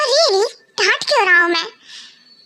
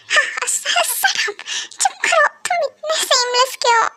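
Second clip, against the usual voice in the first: flustered squeal; 6 semitones higher, slower than usual. Acted. Wife flustered by husband's flirting.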